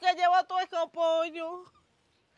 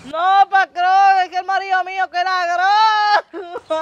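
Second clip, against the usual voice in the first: pleading; 8 dB louder than usual. Acted. Worker pleads with her boss.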